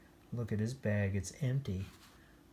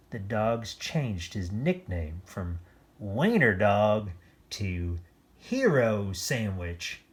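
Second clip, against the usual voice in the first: usual speaking voice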